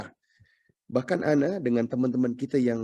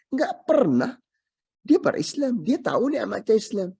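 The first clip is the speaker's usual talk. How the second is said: higher and louder, aggrieved; the neighbour accuses before Allah, raised aggrieved voice; emphatic complaint; 9 semitones higher, 5 dB louder than usual. Acted. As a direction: press the grievance hard.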